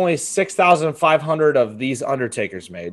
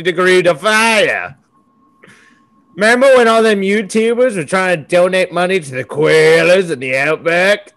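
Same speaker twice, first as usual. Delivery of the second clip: mock Australian accent; 2 semitones higher, 4 dB louder than usual. Host's koala rant in loud mock Aussie accent. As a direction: rant it like a bitter bushman. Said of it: "That accent change".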